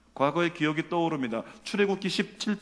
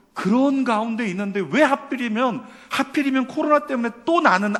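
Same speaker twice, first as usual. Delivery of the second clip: loud, raised-pitch lament; aggrieved complaint; 8 semitones higher, 7 dB louder than usual. Acted. Preacher voicing a believer's complaint about corona, aggrieved. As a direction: protest loudly at the unfairness.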